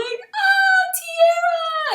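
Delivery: very high, loud tearful shout of a name; mother greeting her daughter after school, shouting high and loud with joy; joyful call. Retold or imitated. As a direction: call out to her daughter across the parking lot, overjoyed. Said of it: "I had tears in my eyes".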